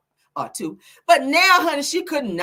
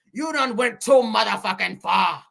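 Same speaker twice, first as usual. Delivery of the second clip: forceful put-on shout; rival's indignant voice. Acting the rival scolding a gossip host, shouted indignation. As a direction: hurl it out in her throat-straining voice. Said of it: "How you talk like that".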